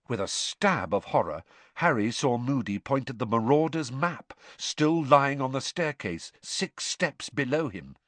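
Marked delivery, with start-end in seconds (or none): none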